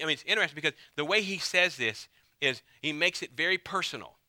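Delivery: ordinary talk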